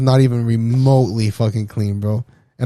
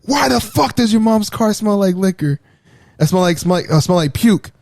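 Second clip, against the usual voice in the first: high-pitched scolding imitation at normal volume; mock angry stepfather; 7 semitones higher than usual. Stepfather bursting into son's bedroom about the car, mock angry. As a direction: imitate an outraged parent demanding answers.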